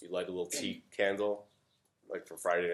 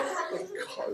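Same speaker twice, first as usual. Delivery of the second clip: relief; 4 dB quieter, slower than usual. Retold or imitated. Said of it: "You're like".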